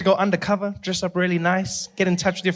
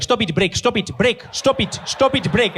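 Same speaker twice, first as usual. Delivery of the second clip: angry father; 6 semitones higher, 3 dB louder than usual. Retold or imitated. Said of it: "his dad was there like".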